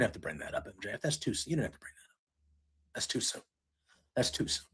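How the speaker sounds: a fan hushed by a cruel line; hushed wince. Real, not acted. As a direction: whisper that it's too soon. Wincing at the insult.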